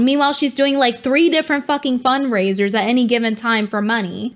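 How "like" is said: ordinary talk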